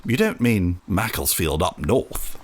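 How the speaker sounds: ordinary talk, nothing marked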